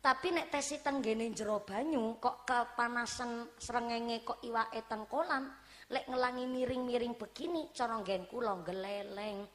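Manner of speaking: ordinary talk, nothing marked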